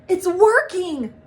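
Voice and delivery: high, loud, slow joyful cry; thrilled it finally works; excited exclaim. A narrator's reading. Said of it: "Buddy exclaimed".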